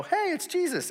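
very high, cartoonish little fish voice; squeaky fish voice. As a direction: play a tiny excited fish spotting Jesus. Fish greeting Jesus in the story.

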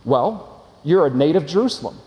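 usual speaking voice

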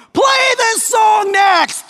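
very high, drawn-out holler; yelling. Acted. Rude audience member at the symphony, yelling. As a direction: bellow a request at the stage. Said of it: "somebody next to you starts yelling".

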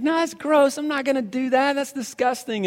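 the son refusing the dog poop brownies; disgusted kid. Retold or imitated. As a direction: push the brownie away. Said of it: "and he's like".

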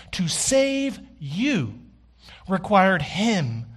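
"save" lifted high, slow and deliberate; slow emphatic repeat. Real, not acted. Preacher repeating the line to the congregation, slow and emphatic. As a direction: hammer "To save you" word by word.

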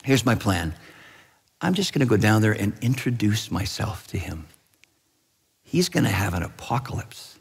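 understated, assured voice of Jesus; calm confiding. Acted. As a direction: lay out the plan quietly and calmly. Jesus calmly tells Father his plan.

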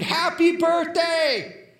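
the whole room bursting out; birthday scream. Retold or imitated. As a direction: yell it out as a surprise party. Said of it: "we screamed".

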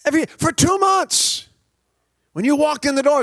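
overwrought confession. Retold or imitated. Classmate's overwrought retold confession.

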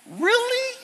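very high, disbelieving "Really?"; mock the panic with disbelief; incredulous. Real, not acted. Mocking friend's panic.

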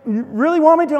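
high, unsure question; wife hesitating, asking high and doubtful. Retold or imitated. Wife asked to taper his hair, high doubtful question.

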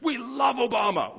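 high, gushing cheer; mock enthusiasm. UK pastor mocking American hype, high and gushing. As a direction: overdo the excitement.

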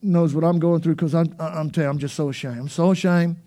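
low, small, mock-pitiful voice; ashamed whimper. Believer confessing shame to nobody, mock-pitiful. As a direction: sound beaten and ashamed, lightly.

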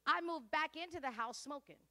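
ordinary talk, nothing marked

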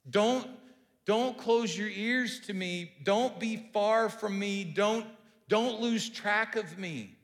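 slow urgent plea, voice lifted; pleading. Acted. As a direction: beg God not to turn away. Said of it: "he's asking God to listen".

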